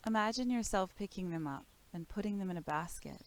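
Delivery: calm, measured body-scan voice with long pauses; guided meditation. Real, not acted. Teacher guides listeners through breath and body awareness, calm meditation voice.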